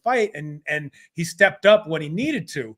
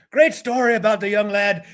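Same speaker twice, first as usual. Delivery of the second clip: put-on sudden light Irish accent, a touch higher and louder; 4 semitones higher, 4 dB louder than usual. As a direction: put on a quick Irish lilt like a commentator. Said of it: "I don't know why I turned Irish".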